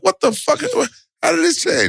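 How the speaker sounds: outraged disbelief. Retold or imitated. Told he's off the pay-per-view deal, high indignant disbelief. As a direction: demand answers in shock. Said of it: "I'm like".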